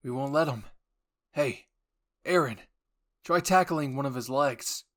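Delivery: loud commanding call; Jean calling orders across the battlefield, loud. Acted. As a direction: shout the order to Aaron. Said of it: "Jean replied".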